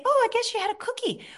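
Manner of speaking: high, quick, light and kind; gentle, accepting. Shown as a demonstration. Kind self-talk after a cookie, light and gentle. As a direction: brush it off kindly, no blame. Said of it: "I say".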